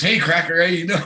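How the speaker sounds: mock-friendly pupil hailing his mate; retold teasing greeting. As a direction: imitate a buddy calling out playfully.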